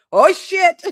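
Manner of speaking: high, loud startled yelp; squeaky panicked dog voice; voicing the startled dog. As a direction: jolt awake in a squeaky panic. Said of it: "went from asleep to".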